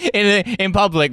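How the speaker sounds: normal talking voice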